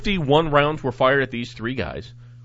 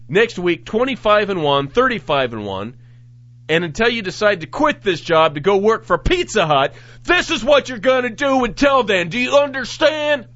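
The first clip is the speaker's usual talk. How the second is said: a hint of a barking sergeant, higher and louder; bossy supervisor; 9 semitones higher, 5 dB louder than usual. Host voicing the patrol supervisor at roll call, bossy. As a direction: lay down the law to the troops.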